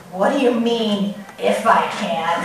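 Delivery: low, quiet tough-guy challenge. Acted. Jesus answering the doubting father, tough and low-key. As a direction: coolly put the doubter on notice. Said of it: "He sounds so tough".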